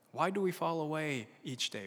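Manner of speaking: ordinary delivery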